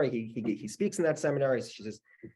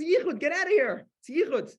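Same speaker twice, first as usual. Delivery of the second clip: very high, loud outraged order; hammed-up frantic protest; retold screaming; 4 dB louder than usual. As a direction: imitate a panicked man yelling her away. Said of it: "Stop screaming at me about Yichud".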